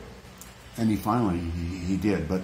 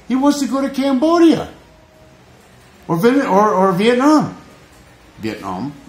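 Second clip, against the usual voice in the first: emotional outburst; 8 semitones higher, 3 dB louder than usual. Real, not acted. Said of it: "I just got really emotional".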